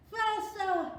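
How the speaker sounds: high loud yell. A narrator's reading. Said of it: "yelled Lucy".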